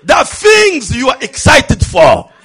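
very loud, high, impassioned sermon; fiery preacher driving each phrase home; full-force preaching. Real, not acted. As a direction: shout the challenge across the church. Preaches challenge to the congregation.

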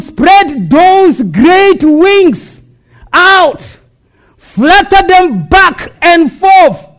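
rousing, high-energy sermon cry; full-force preaching. Real, not acted. Preacher likens eagle's wings to God's word, fervent preaching. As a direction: preach it out, building in fervour.

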